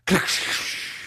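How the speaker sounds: unvoiced mouth-made click and hiss; harsh whooshing lighter noise, imitated; imitated lighter sound. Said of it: "it makes a sound like".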